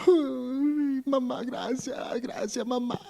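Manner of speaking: a hint of crying, soft and high; tearful boy. Boy thanking his mother for the gift, mock tearful and soft. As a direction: thank mom through mild tears. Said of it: "Y empieza a llorar".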